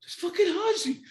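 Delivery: high, loud squeal through laughter; thrilled disbelief. Retold or imitated. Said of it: "I'm like".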